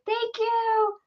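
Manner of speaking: high, drawn-out thank-you; mock-sweet thanks. Driver thanking the dealer for directions, high drawn-out mock thanks. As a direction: lift the pitch right up and hold it. Acted thanks after tense exchange.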